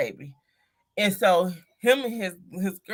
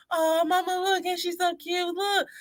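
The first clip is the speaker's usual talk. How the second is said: imitating daughter; 10 semitones higher than usual. Mother voices her young daughter.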